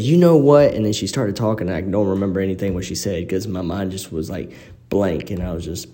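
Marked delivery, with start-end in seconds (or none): 0.0-0.7 angry outburst. Retold or imitated. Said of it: "And she said".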